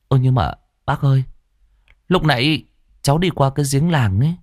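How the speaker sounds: anxious boy blurting bad news; worried boy. A narrator's reading. Boy reports the bike. (giọng cậu bé cao, lo lắng, nói nhanh dần)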